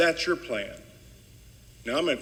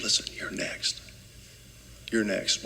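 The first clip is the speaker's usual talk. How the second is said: hushed, breathless "you're next" whispered as a sly threat; mocking whisper; 6 semitones lower, 8 dB quieter than usual. Mocking opponents who whisper to scared teachers, hushed whisper. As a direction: whisper it like spreading a scary rumor. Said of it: "It's shameful to whisper around".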